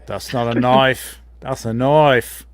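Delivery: slow, broad Australian drawl; Australian accent. Acted. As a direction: stretch the vowels in broad Australian.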